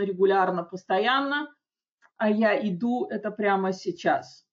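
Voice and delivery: usual speaking voice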